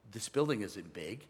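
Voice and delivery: low, soft retold voice of the Lord; quiet divine answer. The Lord answers the overwhelmed preacher, low and quiet. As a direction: speak it low and softly, as God answering.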